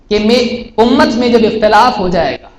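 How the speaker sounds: ordinary delivery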